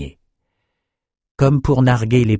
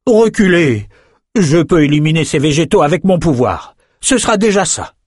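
higher, louder call to stand back; calling out to all; 8 semitones higher, 4 dB louder than usual. Acted. Rennes calling out to the soldiers, louder, higher. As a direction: warn everyone to step back. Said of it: "lança à la cantonade".

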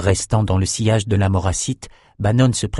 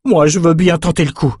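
higher-pitched eager character voice; voiced Brock; 7 semitones higher, 4 dB louder than usual. A narrator's reading. Brock volunteers to try the escape, in a raised voice. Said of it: "dit-il".

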